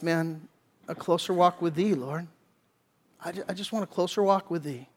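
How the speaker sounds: soft, understated voicing of David's prayer; hushed longing prayer. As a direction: drop to a quiet appeal to God.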